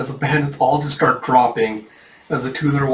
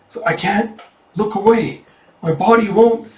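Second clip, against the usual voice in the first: higher-pitched frozen victim; mildly panicked, voice raised in pitch; alarmed; 8 semitones higher, 4 dB louder than usual. Acted. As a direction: strain against a body that won't obey. Frozen bandit under dragon's gaze.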